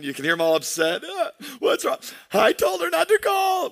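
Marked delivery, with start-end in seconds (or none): 1.0-3.7 put-on high, whiny little-kid voice; a hint of tears in a child's high voice; imitating crying child. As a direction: play the upset son on the phone. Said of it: "you can hear him crying".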